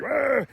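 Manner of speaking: high mock cry; Kevin. Kevin reacts to Buzz's barf threat, high mock cry. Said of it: "Kevin's like".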